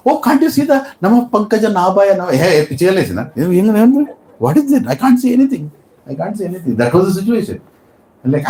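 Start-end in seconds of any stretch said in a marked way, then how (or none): 0.1-6.8 bewildered scoffing. Acted. Bewildered people mock devotee.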